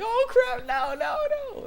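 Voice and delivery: very high, squealed comic panic; mock panic. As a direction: squeal it like you're about to be caught. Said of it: "and he's like".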